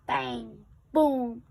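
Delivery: loud booming noise; bang boom. A narrator's reading. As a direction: boom it like a crash. Imitated demolition noises.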